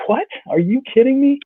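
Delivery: what, are you kidding me, up high; incredulous. Retold or imitated. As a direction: squeak it out in disbelief. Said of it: "I'm just like".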